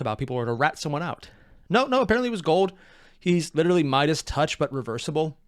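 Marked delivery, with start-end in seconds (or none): none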